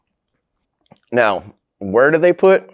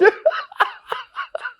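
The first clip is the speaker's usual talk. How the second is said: high squealing laugh; 3 dB quieter than usual. Real, not acted. Cracks up at own joke.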